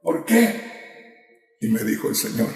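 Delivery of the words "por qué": agudo y fuerte, clamando. Retold or imitated. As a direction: lanza el porqué hacia arriba. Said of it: "Y yo ahí le gritaba al Señor". (grito de angustia al Señor)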